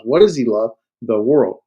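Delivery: usual speaking voice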